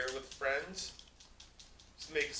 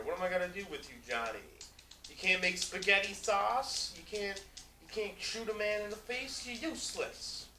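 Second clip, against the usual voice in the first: high, nagging Italian-parent scolding; mobster parent rant; 5 semitones higher than usual. Pretend. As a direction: scold Johnny like a disappointed mob dad. Johnny scolding, spaghetti sauce.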